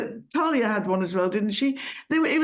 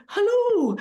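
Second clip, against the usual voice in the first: high, sing-song phone greeting; telephone voice; 9 semitones higher than usual. Retold or imitated. Caller picking up the phone, in a telephone voice. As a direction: put on a polite phone voice. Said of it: "I've developed a Wonderbirds".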